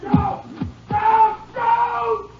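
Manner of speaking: very high, desperate screamed calls; anguished shouting. Acted. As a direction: scream for your brother, then curse. Outlaw cries out for brother, curses.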